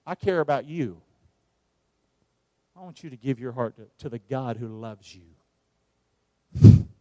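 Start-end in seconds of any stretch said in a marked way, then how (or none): 2.8-6.9 tender plea. Real, not acted.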